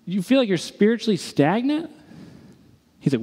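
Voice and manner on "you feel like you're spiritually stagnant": high, rising disbelieving question; can't-believe-it query, pitch raised; incredulous echo. Retold or imitated. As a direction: throw it back as a question, climbing at the end. Throws friend's confession back, incredulous.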